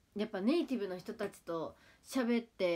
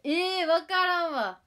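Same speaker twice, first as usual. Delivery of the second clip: higher and louder, slow stretched "えー"; drawn-out exasperation; 8 semitones higher, 9 dB louder, slower than usual. Real, not acted. Reacting to a viewer's Kyushu word, stretched exasperation. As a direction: drag out the puzzled groan.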